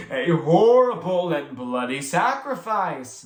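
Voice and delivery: slow, savouring horrible; with relish. A narrator's reading. April echoing the sacrifice with slow relish. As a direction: relish every gory word. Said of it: "with relish".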